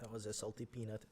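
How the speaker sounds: put-on light Russian accent. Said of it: "you said that in, like, a Russian accent".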